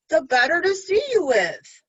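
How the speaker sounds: sly wolf. Acted. Wolf in bed answering the girl, loud and sly. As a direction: sly menace behind sweetness.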